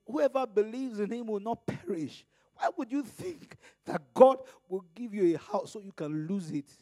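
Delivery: ordinary delivery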